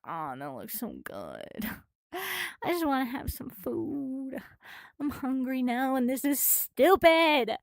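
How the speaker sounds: soft, slow moan of craving; drawn-out, self-pitying hungry lament; hungry longing. Retold or imitated. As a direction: whine softly and slowly for food. Longing whine at sight of food.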